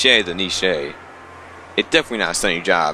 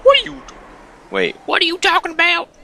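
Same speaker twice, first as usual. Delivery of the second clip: very high incredulous squeak; can't believe what she's hearing; baffled outrage; 3 dB louder than usual. Real, not acted. Reader baffled by character's lie.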